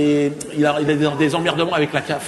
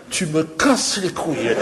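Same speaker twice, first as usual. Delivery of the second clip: slow and heavy, fed-up alien voice; slow annoyed drawl; 2 semitones higher, 5 dB quieter, slower than usual. Acted. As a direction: drag it out, exasperated.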